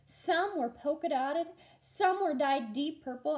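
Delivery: ordinary delivery, nothing marked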